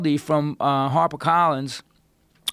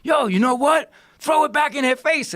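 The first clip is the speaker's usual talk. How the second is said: imitating partner; 11 semitones higher than usual. Business partner urging him to fire back, higher hyped voice. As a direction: push him to do it like a hype man. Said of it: "and he's like".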